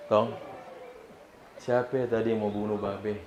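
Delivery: quiet Betawi dad voice; a hint of a Betawi father asking his boy. Wounded Betawi father asking his son, quietly. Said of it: "orang Betawi yang".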